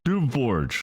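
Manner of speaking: snapped name. A narrator's reading. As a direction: bite off the name like a commander. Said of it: "snapped Ridlock Brimstone".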